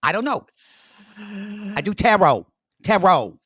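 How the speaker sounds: hammed-up Long Island accent; Joanne impression. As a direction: stretch the words in a mock Long Island voice. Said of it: "and she's like".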